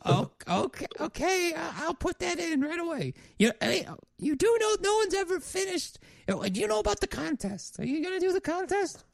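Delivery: very high, accommodating falsetto; diner waitress. Acted. Waitress asks the Ghost about the contest, high-pitched waitress voice.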